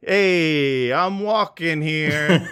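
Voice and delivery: brash New York "I'm walking here" voice; hammed-up New Yorker welcoming the guest; New York accent. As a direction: do a slow Brooklyn cabbie greeting. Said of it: "the Big Apple".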